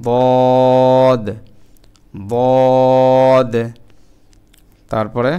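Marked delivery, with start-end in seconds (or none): 0.1-3.5 বদ loud and heavy, filling the mouth; full-mouthed dad. Shown as a demonstration. Teacher drilling Arabic dad, loud and heavy. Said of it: "মুখ ভর্তি করে".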